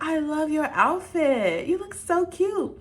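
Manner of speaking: put-on high, slow gushing girl voice; slow high sweet teen compliment; girly compliment. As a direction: gush it up high like a teen fan. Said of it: "she was like".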